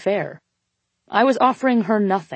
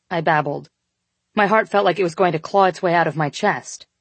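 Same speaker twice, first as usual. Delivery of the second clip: ordinary delivery, nothing marked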